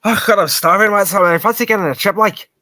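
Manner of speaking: loud, high broad Belfast impression; thick Belfast accent. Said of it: "is an example of how thick his accent was".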